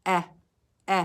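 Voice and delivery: "Eh, eh" with the mouth corners pulled back; showing the class how the mouth moves; short e, mouth pulled back. Shown as a demonstration. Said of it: "Watch my mouth".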